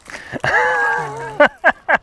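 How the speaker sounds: very high-pitched cackling laugh; high, uncontrolled laughter. Real, not acted. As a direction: burst into a long laugh. Laughing at the end.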